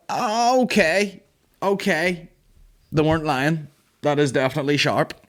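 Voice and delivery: high yelp then a stunned admission; pained surprise. Retold or imitated. Boy cutting his thumb on the ring pull, high pained surprise. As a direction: react as the sharp edge bites, voice jumping up.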